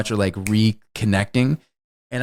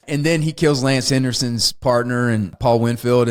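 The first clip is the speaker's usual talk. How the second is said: ordinary delivery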